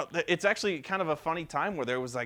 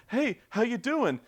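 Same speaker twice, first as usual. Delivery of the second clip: goofy friendly hero; 9 semitones higher, 4 dB louder than usual. Retold or imitated. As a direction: play the hero as a harmless nice guy. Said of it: "John Marston's like".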